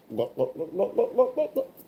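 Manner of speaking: quiet glug-glug rising in pitch; soft, climbing gulp noises; imitated gulping. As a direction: mimic a bottle being chugged. Mimics her gulping down Fireball.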